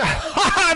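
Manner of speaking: high, loud witch's cackle; old hag cackling at death; evil cackle. A narrator's reading. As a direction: burst into a wicked cackle. Hag laughs mid-rant.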